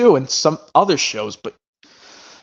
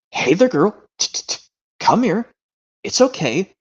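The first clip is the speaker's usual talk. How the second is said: higher, slow coaxing voice; coaxing a puppy; 6 semitones higher than usual. Retold or imitated. Owner coaxing a frightened stray puppy, high and gentle. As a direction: coax a shy dog to come closer. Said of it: "how about this one where it goes".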